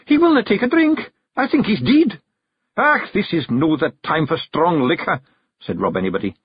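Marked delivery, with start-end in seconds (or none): 0.0-2.2 very high, louder Scots alarm; alarmed yell. A narrator's reading. Jeanie alerts the clan that Rob is dead, alarmed yell. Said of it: "she yelled". 2.8-5.2 higher, grumbling Scottish refusal; Scots clan chief, dismissive. A narrator's reading. Rob refuses his wife's drink, dismissive.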